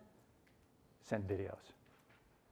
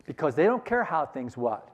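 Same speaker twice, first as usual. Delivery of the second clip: usual speaking voice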